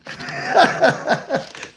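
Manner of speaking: unvoiced high laughter; breathy laugh. Real, not acted. Laughs at dad's joke.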